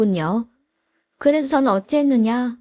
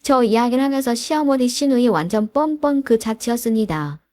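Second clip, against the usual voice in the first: plain reading voice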